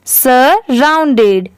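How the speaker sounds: Surrounded stretched out, "saa, round-ded"; slow pronunciation demo. Shown as a demonstration. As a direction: model surrounded slowly for learners. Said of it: "इसकी pronunciation कैसे हो रही है". (Surrounded का धीमा, साफ़ उच्चारण)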